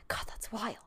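whispered, under-the-breath aside; muttering to herself. Retold or imitated. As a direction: say it privately to yourself, whispering. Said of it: "I've noticed myself saying".

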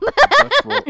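high, loud squeak; squealed joke. Pretend. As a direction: squeak the word out. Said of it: "mispronouncing roll mop".

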